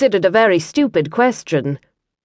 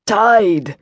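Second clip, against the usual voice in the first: a light hiss through gritted teeth. Acted. Liam in pain grits out one word, lightly hissed. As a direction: force it out past the pain. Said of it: "hissed the word out from between tightly gritted teeth".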